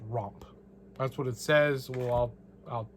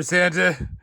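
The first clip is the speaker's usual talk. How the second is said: loud; 3 semitones higher, 9 dB louder than usual. Pretend. Answering Santa loudly.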